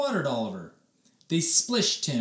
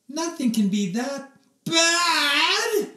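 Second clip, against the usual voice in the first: reassuring mom; 14 semitones higher, 6 dB louder, slower than usual. A narrator's reading. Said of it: "she said".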